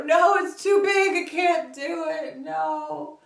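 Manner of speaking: high, loud, slowed mock despair at a huge book; mock defeated whine. To viewers, replaying herself quitting the book, hammed-up whine. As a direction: ham up giving up on it. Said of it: "and go".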